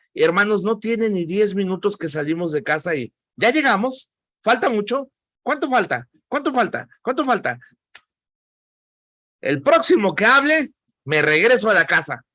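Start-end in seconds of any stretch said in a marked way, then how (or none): 3.3-7.6 high-pitched, insistent child imitation; pestering little daughter. Daughter ten minutes into car trip, imitated high nagging. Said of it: "me pasa con mi hija". 9.4-12.2 acted stern warning, starting high and firm; exasperated dad. Dad to kids nagging in car, acted exasperated warning.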